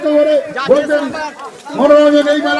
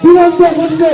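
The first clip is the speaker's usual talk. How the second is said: race caller; 3 semitones higher than usual. Real, not acted. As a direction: call the horse race live, building excitement. Live horse race commentary.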